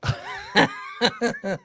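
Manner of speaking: squealing burst of laughter; high shrieking laugh. Real, not acted. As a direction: let out a shrill laughing shriek. Joking about jumping off roofs.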